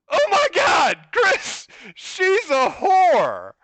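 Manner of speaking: high-pitched acted shout, last words drawn out; mock-horrified call to a friend; alarmed warning. Friends warning Chris mid-kiss, alarmed shout.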